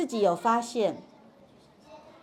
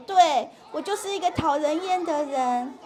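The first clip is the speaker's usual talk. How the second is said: put-on higher-pitched, mildly upset child; 生气稍缓的孩子; 6 semitones higher than usual. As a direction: lightly act a child sulkily agreeing. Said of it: "他就会小声一点点". (稍微提高音调，模仿闹情绪的孩子)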